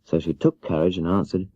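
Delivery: usual speaking voice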